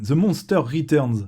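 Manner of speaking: ordinary talk, nothing marked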